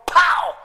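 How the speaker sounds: high loud pow; mock smack sound. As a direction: punch the sound out. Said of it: "Dad goes".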